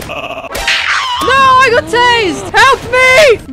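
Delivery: high, loud panicked yelling. Real, not acted. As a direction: yell for help in a panic. Tased and restrained in game.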